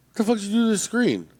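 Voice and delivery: high, accusing question; retold outrage at the eye doctor; mock indignation.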